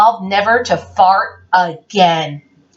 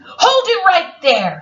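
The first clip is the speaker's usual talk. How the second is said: mock policeman; 9 semitones higher than usual. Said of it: "said the policeman".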